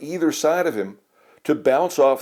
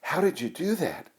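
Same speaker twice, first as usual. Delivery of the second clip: hushed, higher voiced question; mild whisper; 6 semitones higher, 7 dB quieter than usual. A narrator's reading. Sammy to Ned at his seat, hushed wonder. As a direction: ask it softly, not fully whispered. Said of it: "whispered Sammy".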